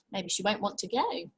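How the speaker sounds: ordinary delivery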